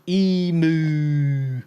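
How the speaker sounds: EMU drawn out as "ee-mooo"; mimicked emu. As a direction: stretch the moo in emu, mocking. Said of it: "She says emu".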